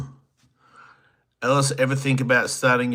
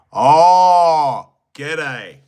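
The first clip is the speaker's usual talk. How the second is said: loud, high Australian "Oh, g'day"; a hammed-up Aussie greeting; Australian accent; 5 semitones higher, 10 dB louder than usual. Australian accent, selling boards to Australia. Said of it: "I sell to Australia".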